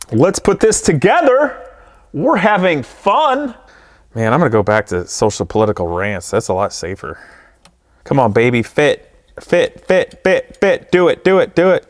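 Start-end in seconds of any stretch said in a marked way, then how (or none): 0.1-3.5 sarcastic. Real, not acted. Sarcastic after pain. 8.0-11.9 urging. Real, not acted. Urging the part to fit.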